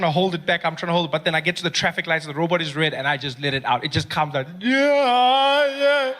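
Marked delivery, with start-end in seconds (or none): 4.6-6.1 wailing sob. Retold or imitated. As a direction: sob and wave off the window washer. Crying driver waving off window washer.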